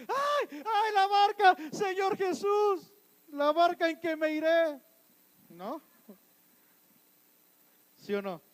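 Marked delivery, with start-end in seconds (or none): 0.1-4.8 high, drawn-out mock wailing; panicking disciples. Frightened disciples wailing to Jesus about the boat, mock panic. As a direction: cry out in a hammed-up panic. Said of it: "¿Cómo estaban los discípulos?".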